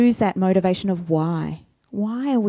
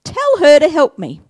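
indignant Martha; 6 semitones higher, 5 dB louder than usual. Acted. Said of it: "She says".

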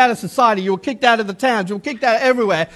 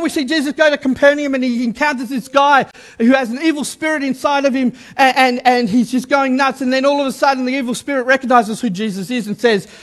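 usual speaking voice

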